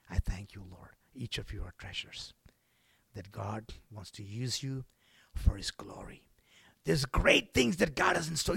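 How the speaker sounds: soft whispered assurance. Acted. Preacher assuring congregation, whispering. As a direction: whisper it to each listener.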